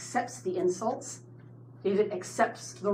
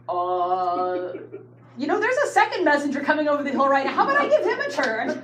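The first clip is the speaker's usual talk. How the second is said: nervous evasive laugh; 6 semitones higher, 6 dB louder than usual. Retold or imitated. Messenger dodges bad news nervously.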